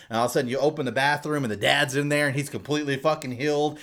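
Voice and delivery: ordinary talk, nothing marked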